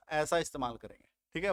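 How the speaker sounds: normal talking voice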